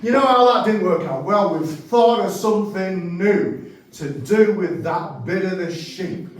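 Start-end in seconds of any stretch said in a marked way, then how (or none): none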